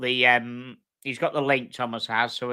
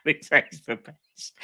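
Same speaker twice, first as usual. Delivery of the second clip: laughing; 7 semitones higher, 5 dB louder, slower than usual. Real, not acted. Laughing at chat joke.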